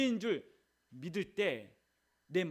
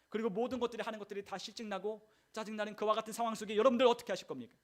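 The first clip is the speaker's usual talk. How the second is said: ordinary talk, nothing marked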